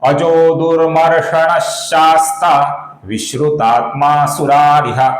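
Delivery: very slow, deliberate Sanskrit chant; full shloka recitation. Shown as a demonstration. Teacher reciting the complete verse for students. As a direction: recite the whole verse slowly and clearly. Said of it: "संपूर्ण श्लोक का उच्चारण देखते हैं".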